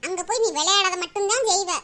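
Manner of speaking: ordinary delivery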